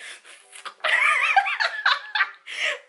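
squealing laugh; high burst of laughter. Real, not acted. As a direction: shriek with laughter.

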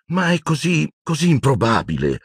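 high-pitched exclamation; desperate. A narrator's reading. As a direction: protest in rising desperation. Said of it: "esclamò lei disperata". (esclamazione disperata, acuta)

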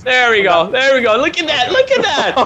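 loud, high thrilled cheering; excited. Real, not acted. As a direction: cheer it on with delight. Thrilled as parachutes deploy.

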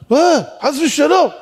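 loud, high, aghast protest; acted shocked passer-by; startled denial. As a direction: blurt it out as if horrified.

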